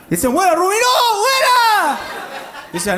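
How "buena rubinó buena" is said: high, loud cheer; cheering fans. Retold or imitated. Fans recognizing the comedian, loud high cheer. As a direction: call out like a fan spotting a star.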